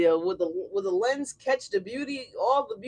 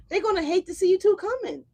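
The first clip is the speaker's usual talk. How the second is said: high, louder, teasing; emphatic tease; 11 semitones higher, 4 dB louder than usual. Real, not acted. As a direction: rub it in playfully. Emphatic prediction about haters.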